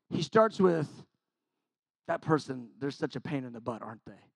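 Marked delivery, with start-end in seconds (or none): 2.1-4.3 soft tempting murmur; insinuating murmur. Retold or imitated. The devil quietly baiting a churchgoer, much softer murmur.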